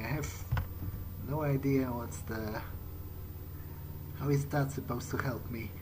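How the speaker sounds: ordinary talk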